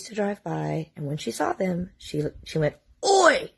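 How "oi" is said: "Oi" yelled loud and much higher. Retold or imitated. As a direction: yell "Oi" out the window to the police. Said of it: "really loud".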